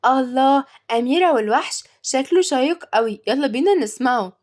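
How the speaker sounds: light up at the film choice; excited. A narrator's reading. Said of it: "واتكلمت تيا بحماس".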